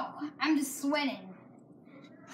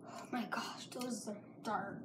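whispering; 6 semitones lower, 16 dB quieter, slower than usual. Real, not acted. Secret reveal, hushed.

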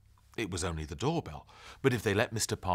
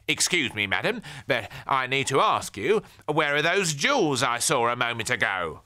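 stiffly polite neighbour; 6 semitones higher, 8 dB louder than usual. Acted. Neighbour demands of Granny.

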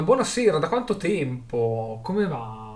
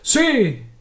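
loud, very high yell; short high rallying cry; crowd's answering shout; 13 semitones higher, 9 dB louder than usual. A narrator's reading. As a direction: roar back assent. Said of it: "Altre voci si levarono".

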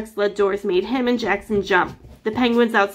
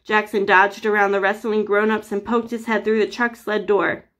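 ordinary delivery, nothing marked

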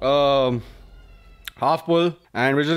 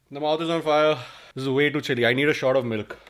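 usual speaking voice

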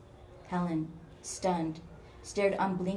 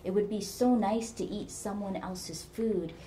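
ordinary delivery, nothing marked

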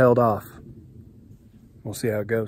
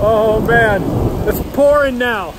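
raised, louder thrilled call; excited call; 8 semitones higher, 5 dB louder than usual. Real, not acted. Camper under a tarp as rain pours, excited call. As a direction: shout over the rain with glee.

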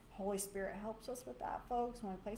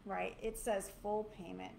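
ordinary talk, nothing marked

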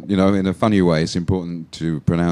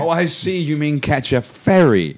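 mock realisation; 7 semitones higher than usual. Teacher pretending to understand the student, mock realisation. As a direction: catch on high, then stretch FERRY with a short E.

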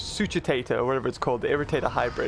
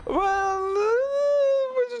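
very high, pitch-shifted voice; drawn-out squeaky processed tone; high-pitched voice effect; much slower than usual. Shown as a demonstration. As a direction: shoot far up into an artificial high voice.

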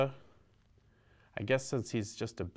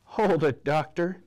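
louder and higher, flustered; sputtering; 6 semitones higher, 6 dB louder than usual. A narrator's reading. Della cutting off the doctor, sputtering. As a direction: blurt it out, cutting him off. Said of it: "Della sputtered".

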